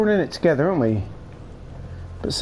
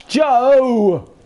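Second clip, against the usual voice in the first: calling out; 10 semitones higher, 7 dB louder than usual. Real, not acted. Calling a teammate loudly.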